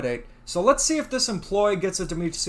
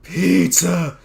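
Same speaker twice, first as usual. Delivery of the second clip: demanding cartoon voice; 8 dB louder, slower than usual. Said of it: "In the Problem Solvers".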